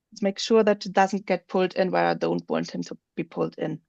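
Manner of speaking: normal talking voice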